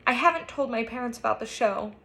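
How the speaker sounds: ordinary delivery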